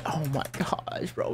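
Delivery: breathless whisper of disbelief. Real, not acted. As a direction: whisper it, slowly. Stunned after the play.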